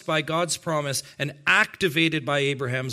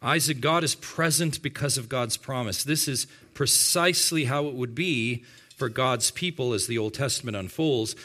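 ordinary talk, nothing marked

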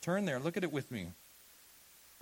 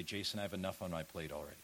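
low, soft, quick objection; imitated excuse; 9 semitones lower, 8 dB quieter, much faster than usual. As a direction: toss off a congregant's excuse under your breath. Voices a weary congregant's excuse.

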